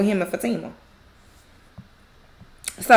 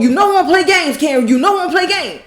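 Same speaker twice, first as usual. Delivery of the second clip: higher, louder retold warning; mock confrontation; 7 semitones higher, 4 dB louder than usual. Zach rolling up on Karen, mock angry warning. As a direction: roll up on someone, imitating his temper. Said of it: "Like, rolling up".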